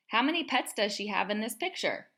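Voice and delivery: plain reading voice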